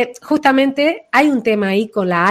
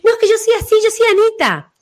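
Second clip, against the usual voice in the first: high, emphatic protest of who she is; insistent protest; 13 semitones higher, 3 dB louder, faster than usual. Retold or imitated. People insisting on their nickname, high and emphatic.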